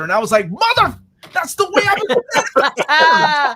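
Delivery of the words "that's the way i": high laughing words, slowed right down. Real, not acted. Host cracking up at the pronunciation, laughing. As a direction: break into laughter mid-sentence.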